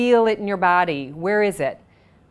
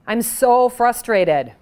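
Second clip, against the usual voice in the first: higher, louder and slow, venting frustration; mock frustrated outburst, louder and higher; 5 semitones higher, 6 dB louder, much slower than usual. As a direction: let the frustration burst out. Tapping script voicing frustration.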